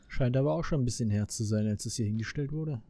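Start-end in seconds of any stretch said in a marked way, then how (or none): none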